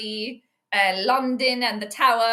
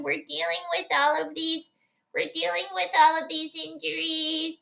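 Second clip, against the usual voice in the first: higher, slow whining complaint of tired players; mock whiny players; 6 semitones higher than usual. Mocking the opposing team's excuses to chat, higher whiny voice. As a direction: whine like a team making excuses. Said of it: "I can already hear it".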